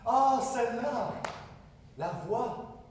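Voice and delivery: loud, high, drawn-out exclamation; big, stretched "oh, that one!"; exaggerated realisation. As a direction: cry out as if it just dawned on you. Exclaims on finally grasping which voice.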